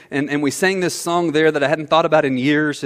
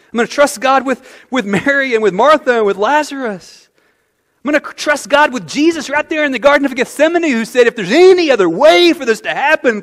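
higher, louder preaching declaration; fervent trust; 8 semitones higher, 5 dB louder than usual. Pretend. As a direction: proclaim the trust with rising fervor. Preacher voicing trusting believer.

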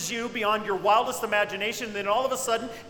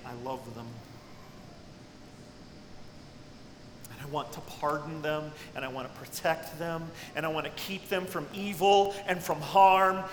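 tender God; 4 semitones lower, 3 dB quieter than usual. Retold or imitated. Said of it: "I picture him saying".

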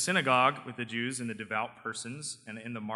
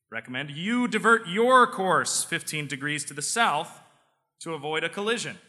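firm captain; 5 semitones higher, 8 dB louder than usual. Acted. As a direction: push back like a firm captain. American captain replies in joke.

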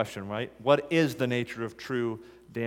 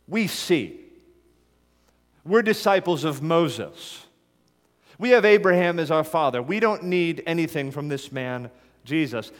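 louder, higher, smugly self-assured; proud Pharisees; 4 semitones higher, 5 dB louder than usual. Acted. Pharisees dismissing Jesus, smug and louder. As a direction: brush Jesus off, sure of yourself.

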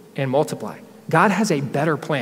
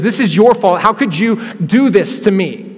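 loud, high, slowing accusation; higher, louder angry outburst, slowing at the end; furious Sarah; 5 semitones higher, 8 dB louder, slower than usual. Retold or imitated. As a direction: hurl the blame at him. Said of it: "Sarah is furious".

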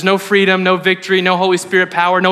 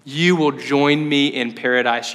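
gentle Jesus; 4 semitones lower, much slower than usual. Retold or imitated. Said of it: "And Jesus goes".

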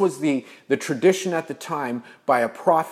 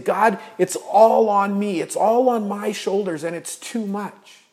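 overwhelmed cry; 7 semitones higher, 7 dB louder than usual. Acted. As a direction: pour out the burden to God. Elijah overwhelmed, crying out to God.

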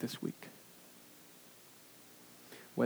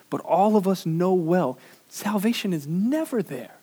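preaching; 5 semitones higher, 7 dB louder than usual. Acted. Preaching climax.

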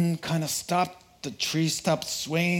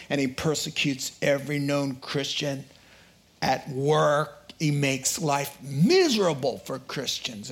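usual speaking voice

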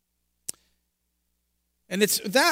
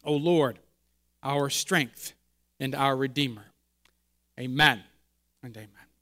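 low, hushed prayer voice; opening prayer; 6 semitones lower than usual. Acted. Pastor leads opening prayer.

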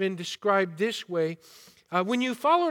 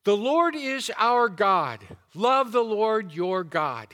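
"The Lord is our God" hammered out loud and slow; weighty, measured punch on each phrase of "Love the Lord your God"; emphatic; 2 semitones higher, 5 dB louder, slower than usual. Retold or imitated. Preacher voicing a rabbi's emphasis on loving God, emphatic. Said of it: "put the emphasis on".

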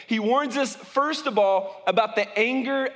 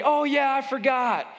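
higher-pitched, mock-innocent excuse; passive-aggressive excuse; 5 semitones higher than usual. Employee excusing an undone task weeks later, falsely innocent. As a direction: play innocent about having forgotten. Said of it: "a way that passive-aggressive people deal".

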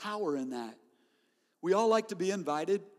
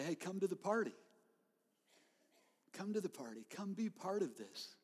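gentle invitation; 10 dB quieter than usual. Acted. Jesus' call voiced to the church, soft and gentle. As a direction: invite them in quietly, as if leaning close.

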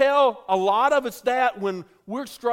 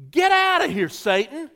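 high, loud rebuke of "Get out of here, Satan"; loud, commanding "Get out of here"; 9 semitones higher, 6 dB louder than usual. Shown as a demonstration. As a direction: shout it out to drive Satan away. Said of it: "in an out loud voice".

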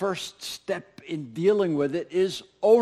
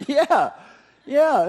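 loud laughing yeah, higher; 8 semitones higher, 7 dB louder than usual. Real, not acted. Laughing at silent congregation.

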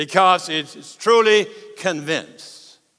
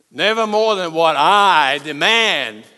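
ordinary talk, nothing marked

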